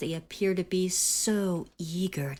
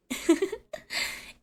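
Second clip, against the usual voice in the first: airy little giggle up high; high breathy giggle; 12 semitones higher than usual. Acted. Captor laughing over the spicy dish, airy giggle. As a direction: let a light giggle slip out.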